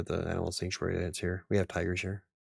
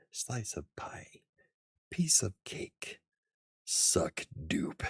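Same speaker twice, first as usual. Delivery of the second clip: slow, mostly voiceless whisper; mock film-quote whisper; 2 semitones higher, 4 dB quieter, much slower than usual. Fan quoting film lines after a laugh, slow whisper. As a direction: draw each phrase out in a playful whisper.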